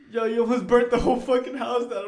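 high, loud scolding; mock angry accusation. Friend blaming him for nearly burning the house, mock furious. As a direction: imitate an outraged friend telling him off. Acted quarrel over burnt pizza.

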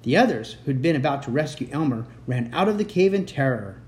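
ordinary delivery, nothing marked

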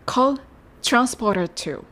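コートランスポーターツー with トランス said like チュランス; chrans sound. Shown as a demonstration. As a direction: turn トラ into チュラ. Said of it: "チュランスというように発音して".

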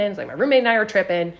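ordinary delivery, nothing marked

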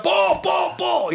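high, urgent repeated call; imitated drill shout. Showing a friend the drilled reaction to a tipped ball, shouting. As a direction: shout it like a player seeing a tipped pass. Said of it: "As soon as it gets tipped up".

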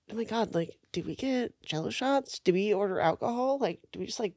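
very soft, tentative planning voice; retold hushed musing. Friends at Trader Joe's planning the party, hushed musing. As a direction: murmur it as a string of little suggestions.

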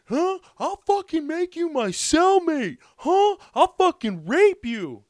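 very high, loud, slow threat; threatening yell. Retold or imitated. Biker threatening the comic, high loud and slow. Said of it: "he's like".

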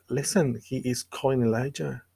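ordinary talk, nothing marked

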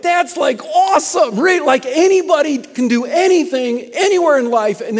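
loud, high, gushing enthusiasm; retold excitement. Pastor gushing back at his critic, loud retold excitement. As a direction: pile on the delight, loud and high. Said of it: "I couldn't be quiet at that point".

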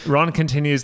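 ordinary talk

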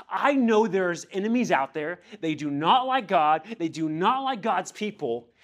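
loud, high, emphatic declaration; Nehemiah. Retold or imitated. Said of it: "He says".

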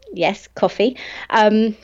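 normal talking voice

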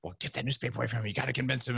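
hushed, unvoiced aside; overheard boss. Boss muttering to the salesman, hushed. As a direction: whisper it like a boss overheard in the back. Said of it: "I hear his boss".

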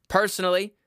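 usual speaking voice

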